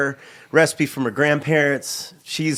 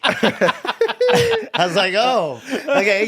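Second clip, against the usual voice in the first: drawn-out, high giggling words; laughing; 8 semitones higher, much slower than usual. Real, not acted. Comic laughing while recalling the awkward intro, laughing. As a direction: laugh your way through it, slowly.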